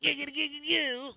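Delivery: high, excited giggity catchphrase; Quagmire impression. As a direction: squeal it like Quagmire.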